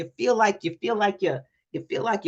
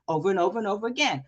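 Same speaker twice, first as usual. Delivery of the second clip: fast, rattled-off repetition; fast drill-like recital; rapid repeat; much faster than usual. Shown as a demonstration. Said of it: "fast repetition demo".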